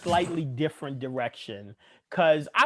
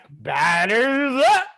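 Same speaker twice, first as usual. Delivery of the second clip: drawn-out, high taunting call; high mock announcer-style holler; mock batter call; 6 semitones higher, 4 dB louder, much slower than usual. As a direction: stretch it out like a clowning killer calling the batter. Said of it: "and you're like".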